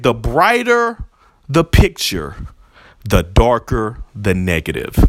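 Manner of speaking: slow, measured quote with a falling end; quoting Kingpin. Retold or imitated. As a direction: say it like a remembered line, deliberately. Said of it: "He said".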